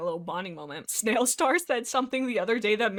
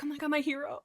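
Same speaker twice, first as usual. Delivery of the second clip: high, soft swoon; mock lovestruck; 5 semitones higher, 5 dB quieter, faster than usual. Snailstar swooning at Owl Ash who caught her, lovestruck. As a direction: gush quietly like a smitten admirer. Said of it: "so brutally in love with him".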